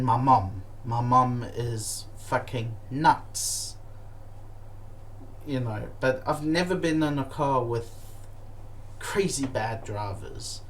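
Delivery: normal talking voice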